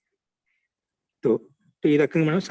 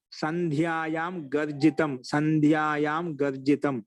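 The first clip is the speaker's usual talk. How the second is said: slow recitation; much slower than usual. Shown as a demonstration. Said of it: "teacher recites Sanskrit example".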